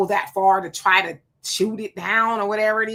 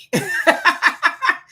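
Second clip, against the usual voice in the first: big burst of laughter, much higher; loud laugh; 10 semitones higher, 5 dB louder than usual. Real, not acted. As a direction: crack up at your own joke. Laughs at own alien joke.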